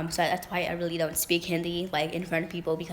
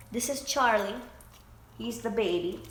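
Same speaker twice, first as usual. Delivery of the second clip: pet talk; 5 semitones higher than usual. Real, not acted. Introducing puppy.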